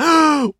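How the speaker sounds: a high, sharp squeal of a gasp; shrill startled squeal. A narrator's reading. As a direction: squeal in pain. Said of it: "девка взвизгнула".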